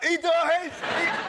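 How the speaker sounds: high, loud yelling of the spoiler; drunken shout. Retold or imitated. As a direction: bellow it like a drunk passing by. Drunk passerby shouts again.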